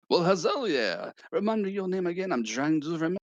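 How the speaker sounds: a kindly chef asking politely; chef character voice. Acted.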